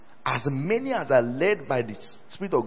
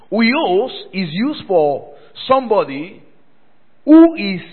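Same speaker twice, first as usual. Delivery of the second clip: loud, high, slow pulpit teaching; emphatic preaching; 6 semitones higher, 12 dB louder, much slower than usual. Real, not acted. Preacher teaching congregation the Greek word, forceful slow preaching. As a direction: spell it out forcefully for a full church.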